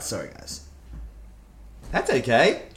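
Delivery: ordinary talk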